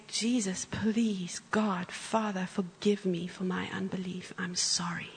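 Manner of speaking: soft, unvoiced whispered repentance; whispered prayer. She retells her own prayer of repentance, whispered. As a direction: whisper your sorry to God. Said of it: "I stood up and I said".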